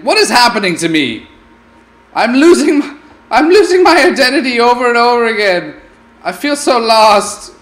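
high, loud hammed-up lament; mock despair. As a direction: wail about losing yourself, overdone. Name vanishing again.

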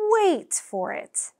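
usual speaking voice

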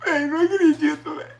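high, sobbing disbelief; crying with joy. Real, not acted. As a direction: weep through the words. Reaching half a million live. (chorando de emoção, voz aguda)

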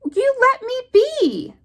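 ordinary delivery, nothing marked

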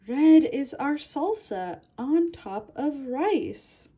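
ordinary delivery, nothing marked

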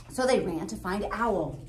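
usual speaking voice

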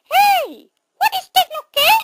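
tiny, very high-pitched mouse squeak. Acted. Mouse character questioning Toby, very high squeaky mouse voice. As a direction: talk like a sped-up cartoon mouse. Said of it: "no more mouse voice".

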